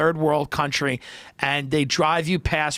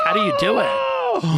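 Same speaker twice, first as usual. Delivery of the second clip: admiring cruise passengers; 7 semitones higher, slower than usual. Retold or imitated. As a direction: gush at him in awe. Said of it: "They're like".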